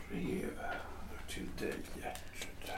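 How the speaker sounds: breathy hush on her name; whispering her name as he hands her the letter; whispered. Acted. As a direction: let it fall to a whisper. Husband reads out her letter.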